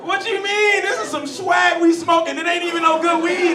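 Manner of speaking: high, very loud outraged protest; indignant yelling. Acted. Friends arguing with Jose over the weed, indignant shouting.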